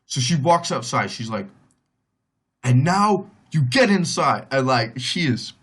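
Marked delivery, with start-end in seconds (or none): 2.6-4.5 raised, higher-pitched angry order; pissed aunt. Retold or imitated.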